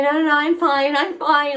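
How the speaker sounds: high, loud brushing-off; insistent reassurance. Retold or imitated. Shaken cyclist tells girl she's fine, insisting.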